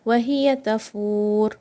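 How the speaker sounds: Wa hiya tafur with the waw held four counts; stretched waw. Shown as a demonstration. Teacher models the long waw for students. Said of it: "stretch waw for four counts".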